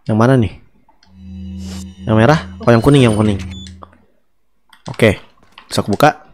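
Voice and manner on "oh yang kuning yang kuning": ordinary talk, nothing marked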